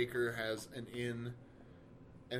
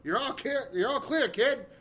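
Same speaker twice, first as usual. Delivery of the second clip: higher, louder movie quote; Han Solo line; 10 semitones higher, 9 dB louder than usual. Retold or imitated. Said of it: "that Millennium Falcon".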